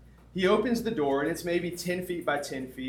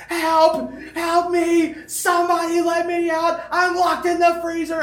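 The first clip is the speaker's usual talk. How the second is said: high, loud desperate screams; screaming for help; 14 semitones higher, 10 dB louder than usual. Retold or imitated. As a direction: yell for help, trapped in the freezer. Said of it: "I'm just screaming at the top of my lungs".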